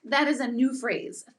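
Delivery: ordinary talk, nothing marked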